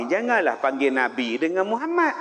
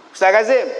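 loud, high call of Ustaz Kazim; called out by title; 4 semitones higher, 7 dB louder, faster than usual. Retold or imitated. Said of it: "Cara jawab tu". (panggil kuat dan tinggi)

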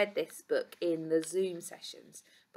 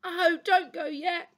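high, slow, loud elephant plea; pleading cry; 10 semitones higher, 6 dB louder than usual. A narrator's reading. Elephant begs the doctor not to go, pleading cry. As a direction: cry out begging them to stay. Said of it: "cried Elephant".

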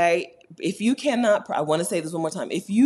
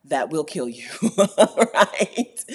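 ordinary delivery

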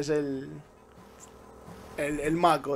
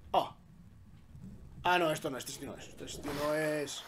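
normal talking voice

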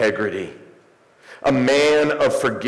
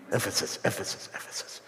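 word repeated softer and softer, fading away; mock echo; 12 dB quieter, much faster than usual. Preacher joking about the hall's echo, mock fading echo. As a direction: play a dying echo with your own voice. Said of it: "little bit of an echo".

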